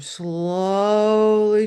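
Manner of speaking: loud, lingering "slowly", held long on the O; drawn-out slowness. Retold or imitated. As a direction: stretch "slowly" with a very long first vowel. Word acted out by stretching it.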